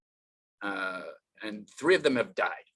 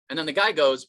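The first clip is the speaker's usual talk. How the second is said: ordinary talk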